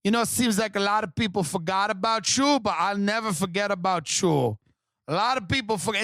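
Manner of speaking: lightly put-on Spanish accent; a hint of a wannabe Spanish girl; gently mocking a devoted poster. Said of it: "She'll be like".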